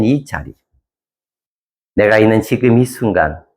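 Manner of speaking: closing prayer. Acted. Pastor leading the congregation in prayer, measured and earnest.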